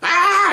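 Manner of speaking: high, sudden mimicked scream; imitated scream. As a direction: scream as if jolted with electricity.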